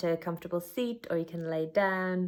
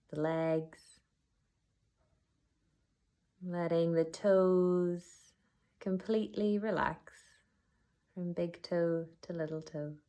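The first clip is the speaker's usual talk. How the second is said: unhurried body-scan guidance with long pauses; guided meditation. Acted. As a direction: guide them gently inward, letting each phrase settle.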